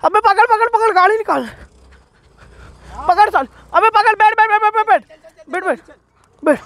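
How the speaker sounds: high urgent shouts of catch and sit; shouting orders. Real, not acted. Shouting at friends while chasing the fleeing man, urgent yells. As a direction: yell at friends to grab him.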